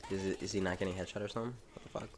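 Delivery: normal talking voice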